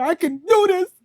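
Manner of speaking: loud, high-pitched mantra. Shown as a demonstration. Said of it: "Say it with more louder".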